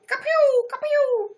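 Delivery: high loud ka-pew blasts; high mouth cannon blasts; cannon sound effect. Acted.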